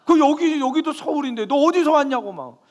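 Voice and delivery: put-on high, loud angry old man shouting; loud, high mock shout of an angry guard; retold angry yelling. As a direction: yell it like an offended guard. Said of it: "막 소리를 막 지르시는 거예요".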